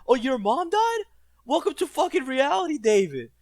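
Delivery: high, loud sarcastic jab; mocking the grieving kid with a loud high sneer; mock taunt. Mock-harsh taunt at David.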